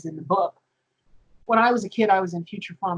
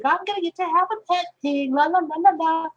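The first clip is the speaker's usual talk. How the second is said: put-on much higher, sing-song childish glee; excited kid; 9 semitones higher than usual. Recalling herself as a kid, gleefully sing-song about her pet pig. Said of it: "And I was like".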